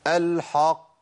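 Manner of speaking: "الحق" with dark back vowel from the h; wrong model: heavy "haqq" in "الحق"; premature heavy ha. Shown as a demonstration. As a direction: thicken "الحق" early, before the qaf. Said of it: "بستعد لتفخيم ال-، القاف من عند الحاء".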